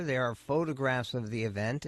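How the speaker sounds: ordinary talk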